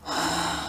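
long unvoiced sigh out; big sigh. A narrator's reading. Boy sighing to his mom after scolding. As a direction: let out a heavy tired sigh. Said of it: "Then I gave a big sigh".

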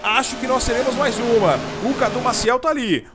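rising live race call to a shouted finish; motorsport commentator. Real, not acted. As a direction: call the final lap at full excitement.

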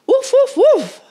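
high, loud, urgent woofs; excited barking. A narrator's reading. Titus barking as he chases the fox. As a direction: bark hard while giving chase. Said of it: "barked Titus as he chased".

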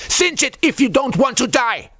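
urgent high-pitched shouted warning; angry bark. Acted. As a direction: drive the order home at full shout. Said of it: "the chief barked angrily".

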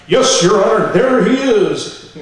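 loud declaration, rising high and drawn out slow; hammed-up witness. Witness points out burglar to the judge, hammed-up proclamation. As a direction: proclaim it like a showy mock testimony. Acts himself as courtroom witness.

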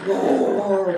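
loud, drawn-out roar word; elephant roar. Acted. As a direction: roar like a distant beast.

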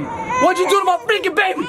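mock outrage. Accusing friend over crying baby.

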